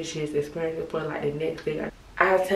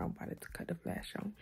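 whispering; 2 semitones higher, 14 dB quieter than usual. Real, not acted. Late night, lights off.

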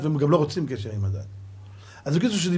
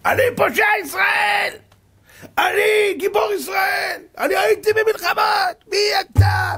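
much higher, louder enraged yelling with a cry; retold furious shout; 6 dB louder, slower than usual. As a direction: play the brother screaming back at the rabbi. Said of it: "התחיל לצעוק". (צעקה זועמת של האח, גבוהה ורמה)

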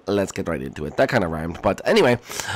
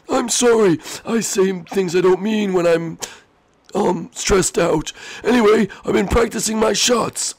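high, half-sorry little voice; high squeaky character voice; 8 semitones higher than usual. Acted. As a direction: apologise in a squeaky voice.

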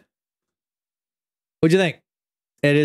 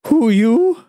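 1 frightened owl; 10 semitones higher, 6 dB louder than usual. A narrator's reading. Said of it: "asked Owl".

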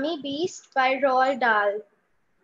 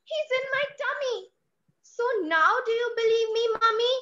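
put-on much higher, fast triumphant child's voice; excited child; 8 semitones higher, much faster than usual. Reciting girl voices the child triumphing over mummy, high and fast. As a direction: rush it out, proved right at last. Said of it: "voice modulation from mummy to child".

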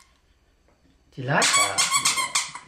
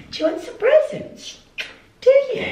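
high, rising coaxing for the dog. Real, not acted. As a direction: tease the dog with excitement. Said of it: "talk to their dogs like a baby".

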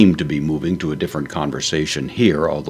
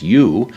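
"you" said higher and louder; stress on YOU; 5 semitones higher, 7 dB louder than usual. Shown as a demonstration. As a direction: lean firmly on the word "you". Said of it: "with emphasis".

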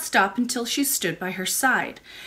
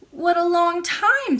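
high, louder, drawn-out exclamation; amazed child; 9 semitones higher, 5 dB louder, slower than usual. A narrator's reading. Princess learns how long the lady stayed, amazed. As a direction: marvel slowly as a little princess.

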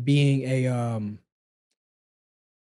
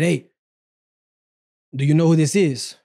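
put-on Trevor's accent; 2 semitones higher, 4 dB louder than usual. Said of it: "With his accent".

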